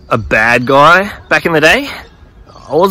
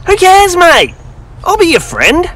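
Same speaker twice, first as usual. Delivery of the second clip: much higher, slow, hearty Aussie mate greeting; Jesus, cheerful. Retold or imitated. Jesus inviting Russell down from the tree, high cheerful. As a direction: beckon him down like an old friend.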